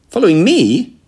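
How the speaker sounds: loud, high astonished question; startled disbelief. A narrator's reading. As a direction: echo it back in shock. Startled reply to being followed.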